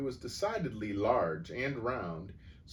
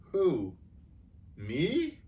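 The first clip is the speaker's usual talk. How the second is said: higher, louder startled question; puzzled; 8 semitones higher, 4 dB louder than usual. A narrator's reading. Boy accused before the school, puzzled. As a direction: ask as if truly baffled. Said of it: "looking genuinely puzzled".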